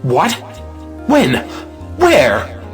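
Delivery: jealous one-word questions, each pushed out; alarmed, demanding outbursts; shocked demand. Acted. Jealous lover demanding answers from her.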